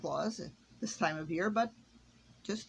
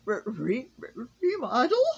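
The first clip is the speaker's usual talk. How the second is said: high, loud stuttering question; stammering elf; 6 semitones higher, 7 dB louder than usual. A narrator's reading. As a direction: stutter in panic over the word. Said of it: "said Cedric".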